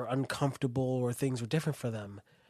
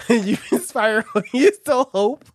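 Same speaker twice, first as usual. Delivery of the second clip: laughing through the words; giggling while talking; 9 semitones higher, 11 dB louder than usual. Real, not acted. Laughing through mock slogans.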